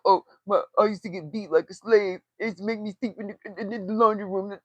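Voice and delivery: a lightly put-on whiny voice, quick in the middle; a hint of a sulky victim voice; mocking her complaint. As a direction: mimic her self-pity, half-mocking. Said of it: "She's always comparing herself".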